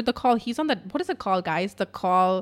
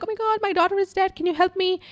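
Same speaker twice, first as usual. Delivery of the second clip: high, rushed imitation of a panicked mom; frantic mother; 10 semitones higher than usual. Distraught mom calls emergency services, mock frantic plea. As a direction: plead frantically, pitched way up, as an impression. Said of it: "a mom's like".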